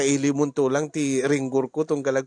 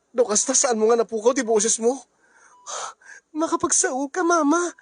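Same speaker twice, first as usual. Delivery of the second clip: excited disbelief; 8 semitones higher than usual. Acted. Family amazed her voice survived.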